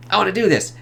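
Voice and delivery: high-pitched, eager mock resolve; imitated fired-up eagerness. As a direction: play someone itching to do it. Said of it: "it's lit this fire in him".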